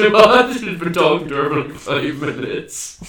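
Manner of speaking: high, loud, laughing "What?"; laughing disbelief. Real, not acted. Telling a friend the pub blunder, loud laughing disbelief.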